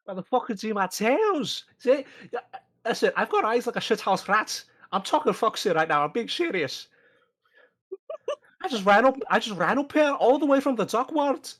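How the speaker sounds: put-on a lightly held Scottish accent, pleading; earnest Scottish messenger insisting. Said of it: "the ridiculous accent".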